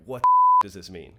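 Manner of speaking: loud, alarmed cry of confusion; panicked outburst. Retold or imitated. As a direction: blurt it out in panic. Retelling panic after the diagnosis.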